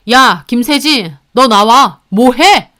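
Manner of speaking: loud, exasperated shout to come out; stern, loud summons; angry summons. Acted. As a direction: call your sister out, loudly and crossly. Husband angrily summons his sister.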